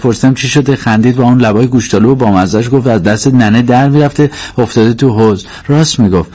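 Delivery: put-on animated girl recounting what she saw; chatty young Maryam. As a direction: tell the gossip in a lively run.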